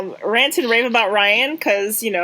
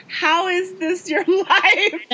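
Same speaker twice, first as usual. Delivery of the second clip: incredulous laugh; 10 semitones higher, much slower than usual. Retold or imitated. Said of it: "And I'm just like".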